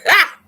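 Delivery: high loud single laugh bark; sharp laugh. Real, not acted. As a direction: burst out with one loud laugh. Laughing at the nickname.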